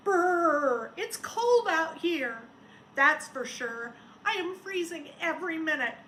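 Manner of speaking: high, loud shivery brrr of a cold snowman; shivering snowman. A narrator's reading. Teacher reading Sneezy to the class, shivering and high. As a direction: shiver through it like a freezing snowman. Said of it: "Sneezy the snowman shivered".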